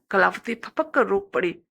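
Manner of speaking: usual speaking voice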